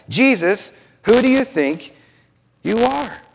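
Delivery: high, incredulous, pausing before the end; indignant challenge. Retold or imitated. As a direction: challenge him as an offended religious leader. Voices offended religious leaders' challenge.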